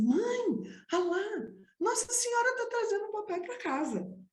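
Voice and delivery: put-on high-pitched, thrilled child's cry; excited child. Child showing mother the car on the hill, high and excited.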